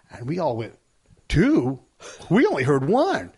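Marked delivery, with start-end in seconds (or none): 1.3-3.3 mild retold surprise, pitch lifting at the end; startled disbelief. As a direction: act out the group's puzzled double take. Group reacts to news of bombs.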